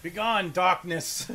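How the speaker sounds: slow grand banishing command; drawn out like a hammy priest; mock exorcist. Mock banishing while vacuuming.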